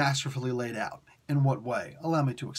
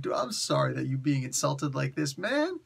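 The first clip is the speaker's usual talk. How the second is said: much higher, giggling through a hammed-up sorry; laughing mock apology; 3 semitones higher than usual. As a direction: pretend to console a friend while cracking up. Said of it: "I just wanna go up and give that dude a hug".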